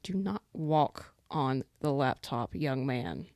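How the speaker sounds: slow, measured telling-off; stern scolding. Real, not acted. Owner to pet about to step on the laptop, stern slow scolding. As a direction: warn a naughty pet slowly and firmly.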